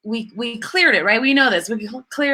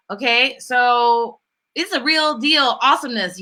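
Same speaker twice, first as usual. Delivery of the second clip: ordinary talk, nothing marked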